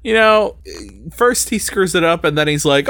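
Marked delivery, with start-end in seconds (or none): none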